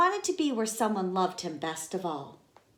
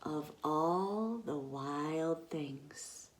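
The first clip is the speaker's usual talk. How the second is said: slow, hushed whisper; awed whisper; 3 semitones lower, 9 dB quieter, much slower than usual. Acted. Teacher marvelling with the children, slow whisper. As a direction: let the wonder sink in.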